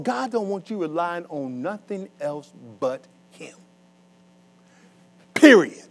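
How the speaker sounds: usual speaking voice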